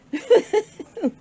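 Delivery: a bright burst of laughter; short laugh. Real, not acted. Laughing at her pile of junk, a quick laugh. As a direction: laugh at her own joke.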